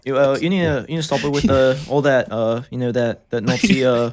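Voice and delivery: mock Liverpool lad; halting, put-on British telling-off; British accent. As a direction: fumble through it in a fake British accent.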